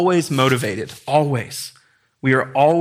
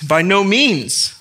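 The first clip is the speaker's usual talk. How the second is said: NO MEANS hit slow, higher and firm; emphatic refusal; 5 semitones higher, 4 dB louder, much slower than usual. Acted. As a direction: stretch out "By no means" as a firm denial. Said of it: "the most emphatic way in Greek".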